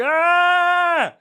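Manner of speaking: high mock dog scream; hammed-up dog scream. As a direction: shriek like a screaming ghost dog. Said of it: "walking around like".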